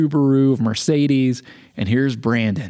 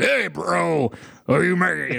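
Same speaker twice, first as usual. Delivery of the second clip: imitated higher-pitched, slurred dude talk; expected bro voice; 6 semitones higher, slower than usual. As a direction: put on a caricature of a tattooed bro. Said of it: "that's not how I expected him to talk".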